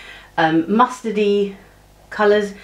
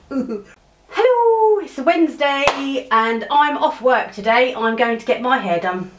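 bright vlog hello; 6 semitones higher, slower than usual. Real, not acted. Cheery vlog greeting, much higher.

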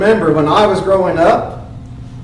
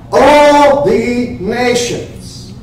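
ALL stretched, slow and sung out higher; drawn-out proclamation; 5 semitones higher, much slower than usual. Acted. Preacher answering who disciples come from, sung out slowly.

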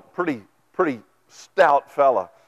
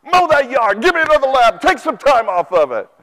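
loud high bossy yelling at husband; hammed-up wife barking orders; nagging shout; 9 semitones higher, 8 dB louder than usual. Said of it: "I don't want you to go home and say".

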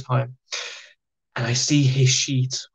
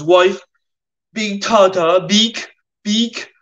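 louder, higher earnest pidgin; broken English detainee; 7 semitones higher, 5 dB louder, much slower than usual. Retold or imitated. Chechen detainee explaining to Miles, loud broken English. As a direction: hammer the few words he knows.

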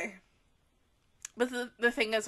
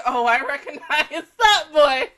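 giggling through the words, louder; laughing quote; 11 dB louder than usual. Retold or imitated. Reacting to a blue-haired character, laughing. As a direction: laugh while recognizing him. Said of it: "and I'm like".

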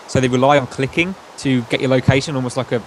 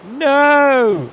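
shouting; 13 semitones higher, 5 dB louder than usual. Retold or imitated. Mock fan reaction to announcement.

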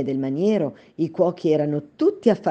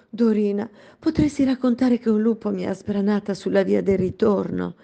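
pleading princess; 6 semitones higher than usual. Acted.

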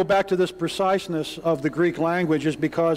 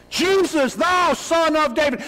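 desperate cry; 10 semitones higher than usual. Shown as a demonstration. Blind beggar crying out to Jesus by the road. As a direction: let it tear out of you like an animal cry. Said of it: "This is a cry that cannot be contained".

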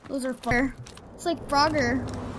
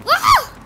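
loud, very high yelp; panicked scream; 10 dB louder than usual. Real, not acted. Player at a scary game moment, panicked scream. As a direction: scream in sudden fright.

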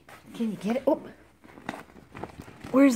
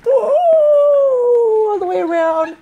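drawn-out playful; 6 semitones higher, 4 dB louder, much slower than usual. Pretend. Narrating car's path.

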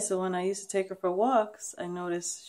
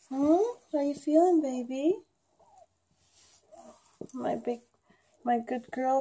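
pet talk; 6 semitones higher, 5 dB louder than usual. Real, not acted. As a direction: coo to a sick pet, pitched up. Owner cooing to her sick dog.

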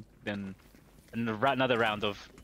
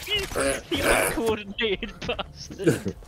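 higher, slow and laughing; laughing mock insult; 10 semitones higher, 5 dB louder, slower than usual. Real, not acted. Losing to teammate's lucky shot, laughing mock insult. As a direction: splutter it through laughter.

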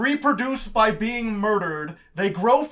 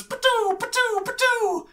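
very high, falling patoo noises; high-pitched imitation of shots bouncing off; mimed bullet ricochets. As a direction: squeal out make-believe gun sounds. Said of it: "all you hear from the orcs is".

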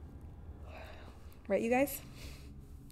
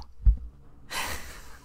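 breathy giggle. Real, not acted. Laugh after whisper.

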